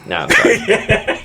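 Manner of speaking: breathy, laughing apology. Real, not acted.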